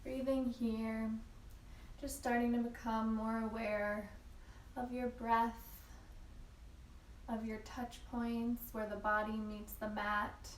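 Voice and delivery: soft, unhurried yoga teacher voice with long pauses; calm, measured breathing cues; guided yoga meditation. Real, not acted. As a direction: guide them gently into stillness, leaving room to breathe. Leads a yin yoga practice.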